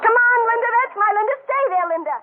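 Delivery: cheering. Acted. Cheering her horse in race.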